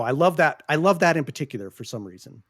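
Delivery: ordinary delivery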